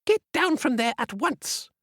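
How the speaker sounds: high, stern scold; stern old witch. Acted. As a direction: command the girl down. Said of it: "cried an elderly voice".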